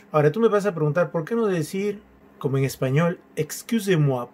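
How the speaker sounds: ordinary delivery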